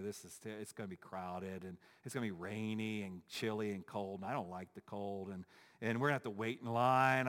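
mope through every complaint like a sulky van rider; grumbling complainer. Retold or imitated. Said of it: "We start to grumble also".

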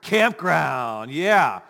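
loud, happy campground cheer; eager mishearing. Retold or imitated. Distracted listener cheering about the campground. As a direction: hear only the fun part, loudly. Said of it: "and I heard".

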